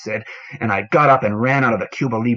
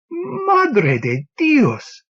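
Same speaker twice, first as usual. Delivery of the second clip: startled cry; much slower than usual. Acted. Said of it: "cried Antonio".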